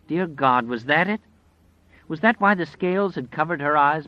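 ordinary delivery, nothing marked

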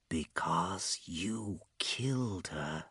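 very soft, slow whisper; sinister whisper. A narrator's reading. A man's shadow accusing him, whispered. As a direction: let each word creep out without voice. Shadow answers his unspoken question.

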